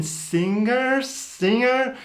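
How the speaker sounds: half-swallow the G in "singer"; in-between singer. Shown as a demonstration. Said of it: "somewhere in between".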